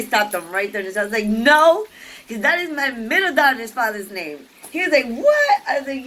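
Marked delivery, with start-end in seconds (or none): 1.3-4.5 loud, higher blurted objection; mock protest, cutting him off; exasperated no. Retold refusal of second name. 5.1-5.6 squeaked, very high and louder; a startled squawk of surprise; imitating a stunned man; shocked what. Guy's shocked reaction.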